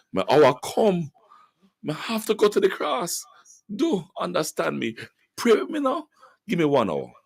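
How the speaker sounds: Jesus pleading in high Jamaican patois. Acted. Radio host imagining a patois devotion, Jesus pleading in Jamaican patois. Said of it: "Jesus said".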